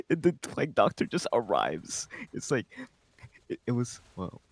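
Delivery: normal talking voice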